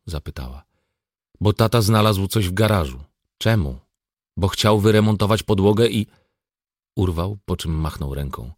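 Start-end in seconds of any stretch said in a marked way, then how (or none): 1.4-6.1 louder, higher, mildly exasperated; exasperated answer. Acted. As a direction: answer an endless why with strained patience. Harried father answering endless why questions. (lekko zirytowany, podniesiony głos)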